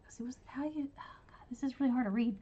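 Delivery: breathless whisper to herself; whispered aside. Real, not acted. Whispering to herself while squinting at a hard title. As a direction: mutter it under your breath, struggling to read.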